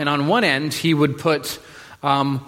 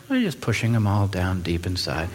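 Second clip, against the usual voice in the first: repressed calm; 8 semitones lower, 4 dB quieter than usual. Retold or imitated. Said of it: "you're like".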